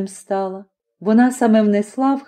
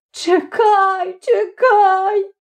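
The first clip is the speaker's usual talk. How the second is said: high tearful wail; crying threat, pitched very high; 11 semitones higher, much slower than usual. A narrator's reading. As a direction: wail it out through tears. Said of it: "заголосила".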